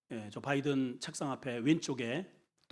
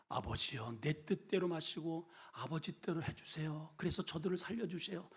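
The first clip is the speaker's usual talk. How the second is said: hushed prayer; 9 dB quieter than usual. Acted. Jesus praying in Gethsemane.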